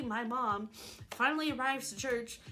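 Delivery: normal talking voice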